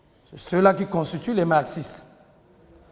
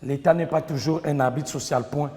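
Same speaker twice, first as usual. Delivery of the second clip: dictating. Shown as a demonstration. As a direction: dictate phrase by phrase, pausing between. Teacher dictating to class. (dictée lente, en détachant chaque groupe de mots)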